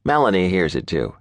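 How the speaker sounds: usual speaking voice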